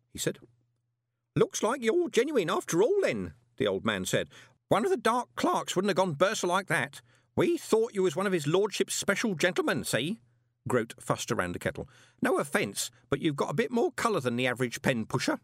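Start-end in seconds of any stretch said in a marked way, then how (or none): 1.4-10.2 put-on high, chatty old clerk; fussy old postal clerk; old man voice. Groat's voice in dialogue. 12.2-15.4 put-on high, chatty old clerk; fussy old postal clerk; old man voice. Groat's voice in dialogue.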